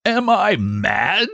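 high, slow, outraged question; thundering. A narrator's reading. Father sees old man in crib, outraged. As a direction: drag it out in rising rage. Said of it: "thundered Mister Button".

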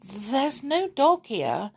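high, slow, tentative voice; timid. A narrator's reading. Wife coming up the stairs to the scene, timid. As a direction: offer it hesitantly in a high voice. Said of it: "coming timidly up the stairs".

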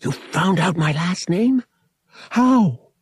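high, loud, astonished questioning; transfixed. A narrator's reading. Boy learning his last name was found, transfixed and excited. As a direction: blurt it out, amazed. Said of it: "suddenly transfixed".

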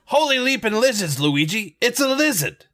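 high excited exclamation; amazed. Acted. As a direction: gasp it out in wonder. Shocked at a dinosaur.

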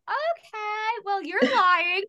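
high, knowing sarcasm; young caller seeing through the doctor, high and sarcastic; sarcastic retort. Retold or imitated. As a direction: call out the lie with a sardonic lilt.

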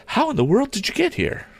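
put-on high-pitched girlish astonishment; amazed young lady. A narrator's reading. Girl asks Tom how he arrived, amazed. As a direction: ask in a high, surprised girl's voice.

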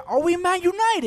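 high, loud mocking question. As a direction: scoff at the team's collapse. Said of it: "that's when H said".

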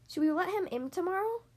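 high, questioning lilt; wondering. A narrator's reading. Worried wife to husband at night, wondering. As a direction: wonder aloud, pitched well up. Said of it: "Missus DeSoto wondered".

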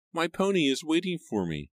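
put-on very high little-boy voice; high-pitched young child; five-year-old boy. A narrator's reading. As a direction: pipe up like a small child telling father. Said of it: "five-year-old Joseph told his father".

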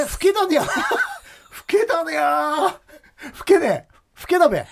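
very high, laughing Akita dialect. Pretend. Teasing a classmate at mock reunion, high Akita dialect. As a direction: tease in hammed-up, stretched Akita dialect. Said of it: "ちょっと秋田弁で話してみたい".

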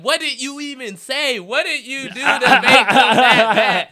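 high, loud disbelieving question; amazed fan. Retold or imitated. A fan asking what he did, high loud disbelief. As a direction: ask in stunned disbelief. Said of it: "one dude was like".

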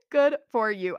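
usual speaking voice

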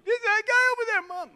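very high, loud squeal; hammed-up little-kid falsetto; whining tattletale child. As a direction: squeal on the culprit to mom. Tattling child answering mom.

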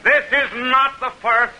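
measured, declaiming public oratory; senator's debate speech to the crowd in measured oratory; formal speech. Acted. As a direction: address the crowd from the debate platform. Douglas closes his debate speech.

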